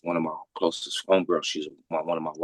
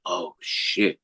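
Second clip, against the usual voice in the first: whisper. Retold or imitated. Retelling his shocked reaction.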